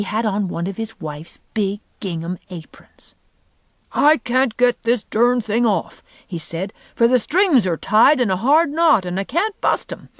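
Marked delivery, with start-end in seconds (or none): 3.9-6.0 higher, slow, exasperated complaint; strained, frustrated man's voice; flustered husband. A narrator's reading. Flustered man stuck in apron. 6.9-10.1 raised, exasperated explanation; lightly voiced frustrated man; flustered husband. A narrator's reading.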